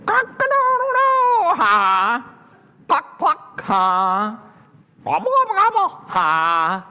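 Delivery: very high bird calls, each capped with ha; crow, cluck and gobble mockingly; laughing farm birds. A narrator's reading. Storyteller voices other animals laughing at her, high crowing and gobbling. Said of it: "other animals laughed at her too".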